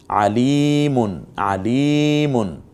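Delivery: আলিমুন recited very slowly with li drawn long; lengthened li. Shown as a demonstration. Teacher demonstrating, stretching the li in আলিমুন. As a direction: hold the li of আলিমুন in Quranic style. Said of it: "লিতে টানলাম কেন?".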